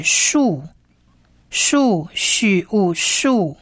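"许物树" spelled out sound by sound. Shown as a demonstration. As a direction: slowly blend "许" and "物" into "树". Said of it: "下方三个点点是短母音物".

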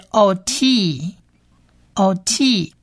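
"O T" very slow, both vowels held long; two long vowels. Shown as a demonstration. Teacher combining two long vowels for learners, long vowels. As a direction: stretch "O T" into long o and long ee, pausing between repeats. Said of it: "两个都是长母音".

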